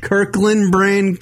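Kirkland brand drawn out slow in disbelief; you can't be serious; incredulous. Real, not acted. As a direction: scoff at the idea, slowly. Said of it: "not Kirkland brand hot dogs are not the best".

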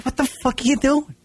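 high, exasperated yelp; annoyed at traffic. Retold or imitated. Cut off in traffic, an exasperated high cry. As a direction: vent at the driver who cut you off. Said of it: "you're like".

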